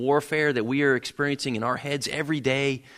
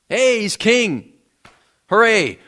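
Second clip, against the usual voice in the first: loud, slow mock cheer; cheering crowd; 7 semitones higher, 9 dB louder, slower than usual. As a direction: hail him like a fickle crowd. Crowd hailing him as king.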